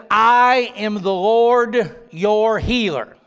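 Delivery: I held long and strong, slow delivery; emphatic I, then measured words; stress on I. Shown as a demonstration. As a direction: declare it slowly, leaning hard on I. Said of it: "is emphatic in the Hebrew".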